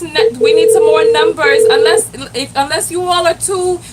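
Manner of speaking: usual speaking voice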